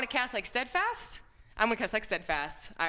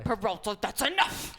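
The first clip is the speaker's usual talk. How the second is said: quoting a TV captain; 2 semitones higher than usual. Retold or imitated. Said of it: "like Brooklyn Nine-Nine".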